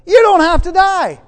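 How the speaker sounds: loud, high, sudden declaration; bursting out. Retold or imitated. Preacher tells a dying man, loud and sudden. I said to him.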